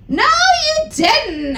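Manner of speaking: very high, slow mock girly retort; hammed-up bratty denial; whiny retort. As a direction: squeak it back in a petulant teen voice. Retorting to Hagrid.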